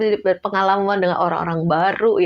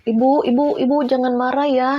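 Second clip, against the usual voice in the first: higher, pleading calm-down; soothing plea; 5 semitones higher, 3 dB louder than usual. Retold or imitated. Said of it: "ke saya". (bujukan menenangkan)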